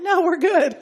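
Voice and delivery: loud, high, laughing no thanks; laughing refusal. Retold or imitated. Teachers refuse their turn, laughing no thanks. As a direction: decline with a laugh.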